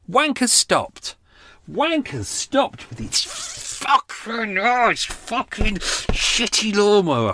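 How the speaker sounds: high, very slow, strained complaint; exasperated rant. Real, not acted. Vlogger ranting about neighbour's lawnmower, slow high exasperation. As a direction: drag each word out in disbelief. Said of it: "really furious".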